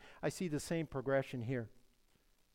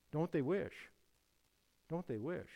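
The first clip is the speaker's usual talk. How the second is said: usual speaking voice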